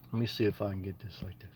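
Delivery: hushed aside, barely voiced; whispering to himself. Real, not acted. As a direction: mutter it to yourself while fiddling with the screen. Muttering to self while blocking.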